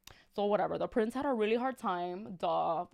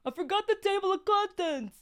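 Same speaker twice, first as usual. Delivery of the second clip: much higher put-on voice; second try at a voice; 10 semitones higher, 4 dB louder than usual. Reader retries Jack's line in a much higher put-on voice. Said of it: "I'm not really good at accents".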